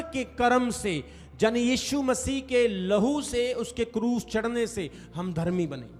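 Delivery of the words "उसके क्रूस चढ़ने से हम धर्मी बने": normal talking voice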